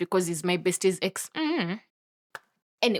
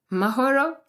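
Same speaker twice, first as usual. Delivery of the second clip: higher, questioning name with a rise; puzzled; 5 semitones higher than usual. Retold or imitated. Crush recognises the woman, puzzled. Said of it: "He was very puzzled".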